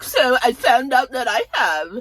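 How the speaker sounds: a high, grand lead-in to bad news; dramatic Karen. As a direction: build up to the big news. Voicing Karen's announcement.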